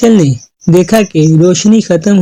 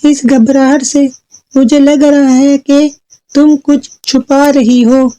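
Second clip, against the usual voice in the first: high, deliberate mother voice; suspicious mother; 7 semitones higher than usual. Acted. Mother pressing daughter for the truth, suspicious high voice. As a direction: probe with pauses in a raised voice. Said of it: "इसकी माँ ने कहा".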